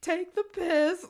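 high, loud, slow drawn "Take the piss"; mocking sister quote; shrill mock sister. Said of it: "and she's like".

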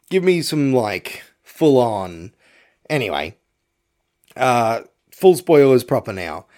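ordinary talk, nothing marked

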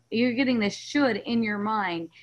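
ordinary talk

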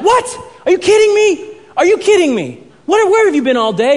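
high, loud disbelieving protest; indignant outburst. Retold or imitated. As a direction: act out an exasperated teen student. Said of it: "he just unloads on Mister Miyagi".

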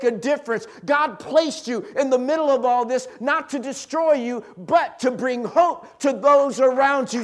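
loud, high, rousing sermon voice; full-force preaching. Real, not acted. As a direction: drive it home to rouse the congregation.

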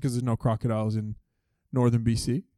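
low, soft, understated answer; deadpan retort. Retold or imitated. Boy correcting his counselor about crocodiles, low deadpan. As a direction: drop it low and quiet, matter-of-fact.

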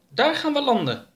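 very high, cheerful alien voice; eager alien Oroka. Acted. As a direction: point out the lake and decide. Oroka picks a landing spot.

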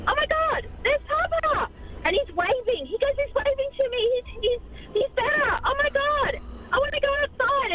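little son's voice. Retold or imitated. Young son telling mum his dead papa is waving outside, high and excited. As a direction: play the small boy who sees his grandpa at the window. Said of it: "and he goes".

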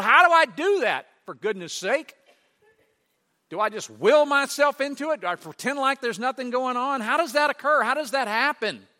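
high, loud rising questions; fervent pleading preacher; preaching build. Acted. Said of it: "preaching builds".

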